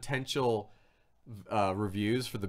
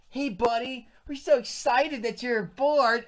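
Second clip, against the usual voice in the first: high, drawn-out fake enthusiasm; mock gushing welcome; 10 semitones higher, 5 dB louder than usual. Music people welcoming a newcomer, fake excited. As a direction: gush with put-on friendliness, high and slow.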